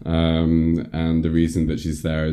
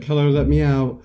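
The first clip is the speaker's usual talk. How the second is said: high, louder imitation scream; mock scream; 10 semitones higher, 5 dB louder than usual. Podcaster voices patient screaming at camera, mock scream. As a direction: call out as the trapped girl, hammed-up. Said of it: "she screams at it".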